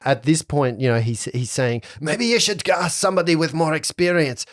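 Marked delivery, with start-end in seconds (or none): 2.0-4.4 put-on high, nervy French-accented excuses; wild French accent. Captain dodges the haunted job, nervy French accent. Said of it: "gone a little more wild".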